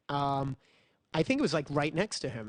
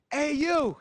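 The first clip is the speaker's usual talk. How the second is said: calling out; 11 semitones higher, 4 dB louder than usual. Retold or imitated. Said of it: "He goes".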